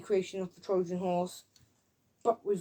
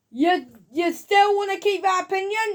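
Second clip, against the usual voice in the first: high, loud acted question; mock challenge; 10 semitones higher, 6 dB louder than usual. Reviewer acting the challenge to the Frenchman, high and loud. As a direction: throw it up as a hammed-up challenge.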